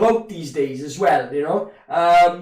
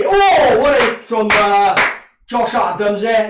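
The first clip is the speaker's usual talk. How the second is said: excited outburst; 3 semitones higher, 7 dB louder than usual. Real, not acted. Big tackle interrupts his chat.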